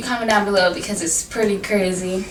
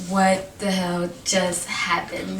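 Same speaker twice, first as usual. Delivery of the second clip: slow, low-key retold shock; quiet, drawn-out mock disbelief; stunned disbelief; 3 semitones lower, 6 dB quieter, slower than usual. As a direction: say it softly and slowly, stunned. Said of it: "I'm so shocked".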